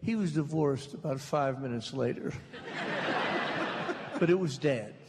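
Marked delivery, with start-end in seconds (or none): 2.4-4.1 high airless giggle; a silent wheezing laugh; breathless high laugh. Real, not acted. Storyteller laughing at his own father's divorce, breathless high giggle.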